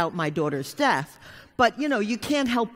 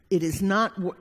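ordinary delivery

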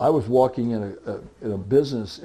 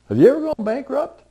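blunt stranger; 14 semitones higher, 9 dB louder than usual. Retold or imitated. Stranger's blunt question retold.

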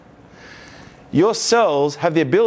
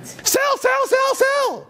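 shrill, much higher panicked cry; panicked sell; much slower than usual. Retold or imitated. Panicking mums and dads dumping stock, very high panicked squeal. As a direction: squeal it like a panicking small investor. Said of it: "mums and dads are like".

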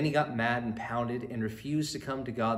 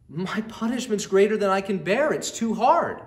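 loud, much higher desperate outcry; anguished cry; 9 semitones higher, 10 dB louder than usual. Acted. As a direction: wail it out in despair. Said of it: "cried Cain".

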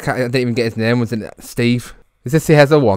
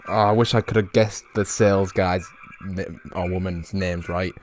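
ordinary talk, nothing marked

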